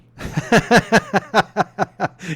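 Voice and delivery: big burst of laughter at his own joke; hearty real laugh; loud laugh. Real, not acted.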